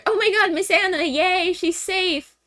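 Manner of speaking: high-pitched put-on joyful squeal; mock cheering. Voicing everyone cheering the rescue.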